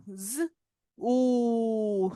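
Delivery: ज, pause, then ऊ held long; long OO, sounded out. Shown as a demonstration. Teacher breaking zoo into sounds, long ऊ. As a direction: separate ज and stretch ऊ. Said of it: "long ओ का sound आ रहा है".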